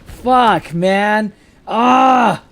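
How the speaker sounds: loud, high angry shout; frustrated yell. Real, not acted. Raging after another hit.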